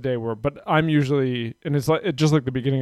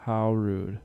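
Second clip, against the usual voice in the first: mock offended; 3 semitones lower, 6 dB quieter, much slower than usual. Real, not acted. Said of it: "just so she knows I'm kidding".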